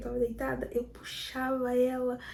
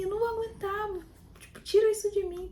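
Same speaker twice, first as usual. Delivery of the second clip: pained plea; 7 semitones higher than usual. Retold or imitated. Begging husband during labour.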